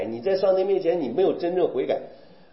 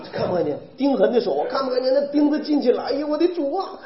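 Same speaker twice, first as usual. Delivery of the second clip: mock anguish; 7 semitones higher than usual. Imitating anguished viewers of crucifixion film.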